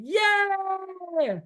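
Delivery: put-on squealing happy child, high and loud; excited kid. Shown as a demonstration. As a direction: cheer like a thrilled little kid. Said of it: "make a sound of a happy child".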